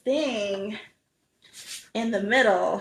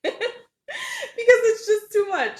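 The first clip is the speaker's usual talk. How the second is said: high, laughing through the words; 13 semitones higher, slower than usual. Real, not acted. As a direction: laugh it out, amused. Laughing over a viewer comment.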